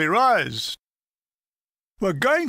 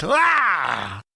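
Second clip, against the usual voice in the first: yelp of pain; 3 semitones lower, 3 dB louder than usual. Retold or imitated. Falls on his head.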